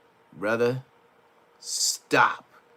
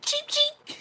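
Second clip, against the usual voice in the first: much higher squeaky chick peeps; chicken chirp. Retold or imitated. Mocking the fighter as a chirping chicken, high squeak. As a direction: peep like a tiny chick. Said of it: "They said".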